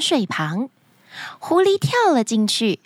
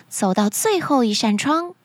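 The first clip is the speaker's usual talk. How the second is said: ordinary delivery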